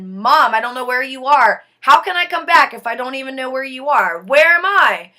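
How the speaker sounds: loud and fast, panicked questions; desperate plea. Retold or imitated. Daughter lost in the void calling to mom, loud and panicked. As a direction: beg to be found, rushing. Pleading with unseen mother.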